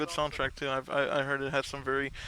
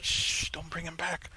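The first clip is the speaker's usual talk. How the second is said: hushed whisper; 11 dB quieter than usual. Pretend. Hushing the clone.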